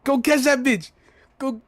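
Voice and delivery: loud, high yell; hyped shout. Real, not acted. Streamer cheering himself on to chase Fang, loud high yell. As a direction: shout yourself on to chase her.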